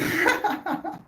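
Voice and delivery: high, rapid run of laughter; helpless high-pitched laugh. Real, not acted. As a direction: crack up in a high giggle. Laughs at own joking exchange.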